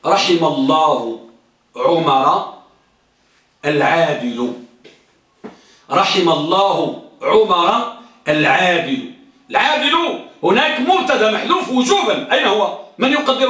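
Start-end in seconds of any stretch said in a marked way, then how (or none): 0.1-4.5 slow, low recitation of the example; slow low chanting of a grammar example; recited example. Shown as a demonstration. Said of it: "ها، مثلا". 5.9-9.1 slow repeat of the example, stressing عمر; steady slow recitation. Shown as a demonstration. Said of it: "ها، مثلا".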